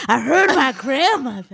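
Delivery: teasing imitation of eyes lighting up; imitating Jay. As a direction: mimic her eager tone with a laugh. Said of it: "the way Jay's eyes lit up".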